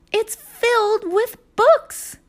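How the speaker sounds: high, slow thrilled call; excited shout. Acted. Annie calls down to Jack, high, slow and thrilled. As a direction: savor the big news.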